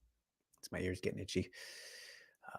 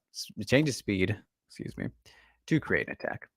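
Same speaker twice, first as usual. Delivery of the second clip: steady narration read; reading a script aloud; 2 semitones higher than usual. Acted. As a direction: read it like a voiceover. Reads Ghost's breakdown script.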